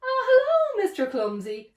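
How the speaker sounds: cheerful greeting. A narrator's reading.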